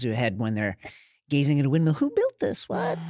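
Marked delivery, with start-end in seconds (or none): 1.9-3.0 much higher, wide-eyed voice; naive wonder. Retold or imitated. Ygritte amazed by a windmill, high naive voice. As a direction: squeak it out like a wild girl amazed.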